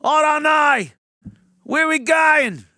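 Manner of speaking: high, loud shouted voice of protesters running; excited running shout. Retold or imitated. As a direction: yell it while charging through a line. Voicing protesters running through.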